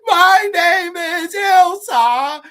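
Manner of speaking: high, slow, loud wailing cry; mock scream. Girl breaking down, screaming her name. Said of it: "finally breaking down and screaming".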